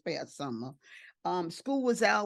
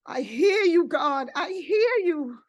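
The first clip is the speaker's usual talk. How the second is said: high, loud, crying out in prayer; tearful cry to God; 8 semitones higher, 8 dB louder than usual. Retold or imitated. Woman on her knees answering God, tearful cry. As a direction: cry it out upward, re-living the tears. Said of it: "I started crying and I said".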